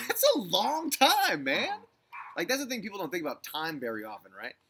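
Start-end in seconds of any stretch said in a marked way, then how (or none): 0.0-1.7 laughing. Real, not acted. Laughing at the thought of time.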